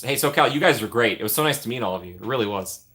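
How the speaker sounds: normal talking voice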